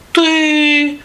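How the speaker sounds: very high squeaky mouse voice. A narrator's reading. Mice admit the rooster did it, sheepish squeak. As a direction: squeak a small, sheepish answer. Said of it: "вже тихіше підказують".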